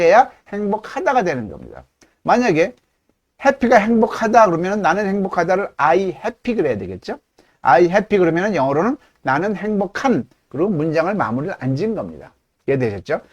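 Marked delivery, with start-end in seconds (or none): none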